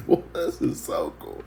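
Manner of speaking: very high, gushing mock delight; thrilled about the torture rack; upbeat movie guy. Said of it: "he's like".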